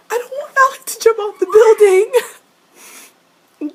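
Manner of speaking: very high, loud squealed plea; overwrought wail. Real, not acted. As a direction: escalate into a shrieking, hammed-up plea. Escalating distressed fan plea.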